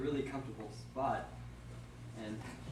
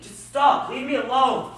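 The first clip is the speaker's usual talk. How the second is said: loud and much higher, furious; angry shout; 11 semitones higher, 16 dB louder, slower than usual. Acted. Demetrius shouting at Helena to leave him alone. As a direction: bark him off, fed up.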